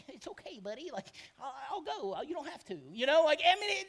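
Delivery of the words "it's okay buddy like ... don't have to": much softer, gentle comfort to a buddy; reassuring. Retold or imitated. David gently comforting the huge crying King Saul. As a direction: pat him on the back with your voice. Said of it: "Massive King Saul".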